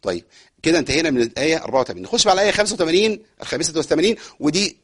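normal talking voice

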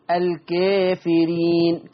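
الكافرين recited slowly, the alef leaned toward e; تقليل الألف في الكافرين; taqlil. Shown as a demonstration. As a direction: draw out الكافرين with the alef tilted. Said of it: "المقلل قولا واحدا".

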